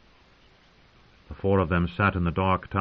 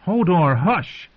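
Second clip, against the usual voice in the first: higher, louder command to hush; sharp hushing; 10 semitones higher, 4 dB louder, slower than usual. A narrator's reading. Bran to the yelling Hodor, sharply hushing. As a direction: call him to order.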